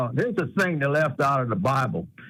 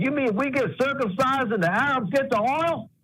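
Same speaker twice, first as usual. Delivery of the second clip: Moses punchline; 8 semitones higher than usual. Retold or imitated.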